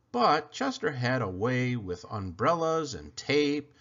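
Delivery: ordinary delivery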